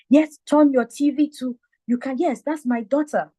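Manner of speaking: high, fast and eager bragging; excited boasting. Retold or imitated. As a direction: rush to tell everyone to tune in. Dad calling people about TV.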